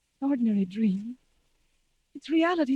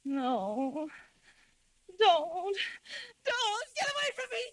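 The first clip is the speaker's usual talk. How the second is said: crying out softly in terror, voice climbing much higher; frightened sleep-talking; 4 semitones higher, 6 dB quieter, slower than usual. Acted. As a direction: plead with the figure in a nightmare, half asleep. Nightmare in a fretful sleep.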